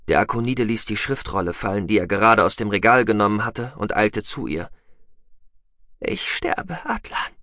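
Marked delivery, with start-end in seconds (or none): 6.0-7.3 put-on high, soft whisper of a dying girl; fading, higher and softer; weak, dying voice. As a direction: breathe it out with failing strength. Dying woman speaks to Atlan.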